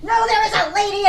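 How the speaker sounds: high, very loud yell; mock screaming shop worker; imitated scream. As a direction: shout it out for everybody to hear. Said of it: "she's screaming to fucking everybody".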